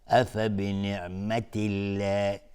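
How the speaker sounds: very slow recitation, light drawn-out l in الله; thin Allah, open mouth. Shown as a demonstration. Teacher showing mouth position, thin slow الله.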